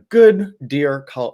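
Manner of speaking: ordinary talk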